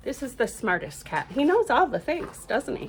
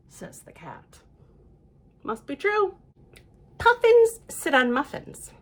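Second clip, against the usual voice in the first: ordinary delivery, nothing marked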